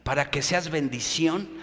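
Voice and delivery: preacher dropping his voice to a slow, hushed close; quiet. Acted. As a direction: pull them in close, slowly. Preacher drops to intimate close.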